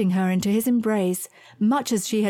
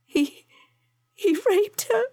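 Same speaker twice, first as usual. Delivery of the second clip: halting, high and tearful; struggling for words; 12 semitones higher than usual. A narrator's reading. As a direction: force it out between gasps. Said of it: "gasped for breath and struggled for words".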